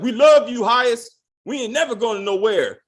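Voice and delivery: high, loud hyped-up cheering; mock hype man shouting praise. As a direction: gas him up like an overeager hype man.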